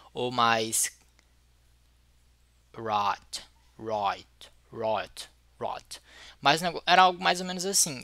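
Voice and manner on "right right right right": right said low and soft with a centralized vowel, near "roit"; centralized diphthong. Shown as a demonstration. Teacher attempting the islanders' sound, centralized diphthong. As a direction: try out right several times, vowel pulled to the centre. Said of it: "eles centralizavam mais os ditongos".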